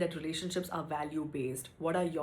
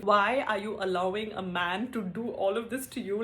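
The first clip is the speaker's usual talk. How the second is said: ordinary talk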